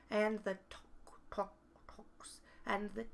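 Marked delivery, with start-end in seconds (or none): 0.7-2.4 whispered tock. Acted. Ticking clock sound effect.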